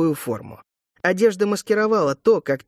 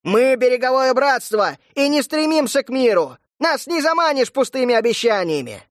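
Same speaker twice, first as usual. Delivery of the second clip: defiant shout; 8 semitones higher, 3 dB louder than usual. A narrator's reading.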